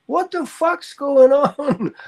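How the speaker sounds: can't believe the fridge is online; amused outrage at the Wi-Fi fridge; disbelief. Real, not acted.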